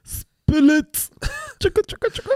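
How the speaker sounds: tiny, very high squeak; playful squeaked jingle with a giggle; sound-effect voice. Pretend. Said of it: "so Soundeffekt".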